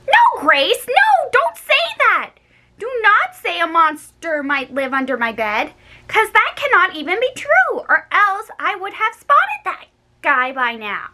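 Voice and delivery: high frantic denial; panicky no. A narrator's reading. Girl frightened, begging Grace not to say it.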